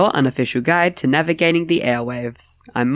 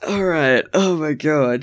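normal talking voice

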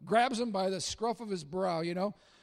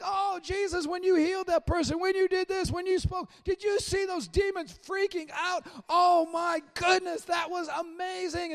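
much higher, breathless fan-like gushing; gushing disciples; 8 semitones higher than usual. Retold or imitated. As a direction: rave to Jesus like starstruck kids. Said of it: "They're like".

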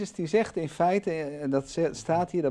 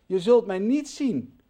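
raised, louder farewell voice; Jesus saying goodbye; 9 semitones higher, 9 dB louder than usual. Acted. Teacher voices Jesus sending disciples ahead, raised loud farewell. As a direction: call it out high like waving them off.

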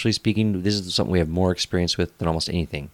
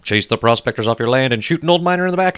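put-on booming kids show opener; 5 semitones higher, 7 dB louder than usual. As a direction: announce it like a circus ringmaster. Podcast opener, announcer hype.

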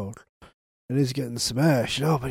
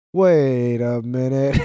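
slow realisation; 4 dB louder, slower than usual. Retold or imitated. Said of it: "the whole cast is like".

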